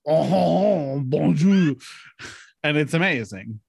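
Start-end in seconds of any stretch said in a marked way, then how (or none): 0.1-1.8 drawn-out imitation French accent; mock French-Canadian accent. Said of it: "you're just Canadian and you're just like".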